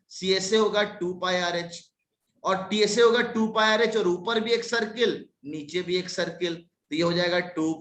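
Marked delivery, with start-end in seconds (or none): none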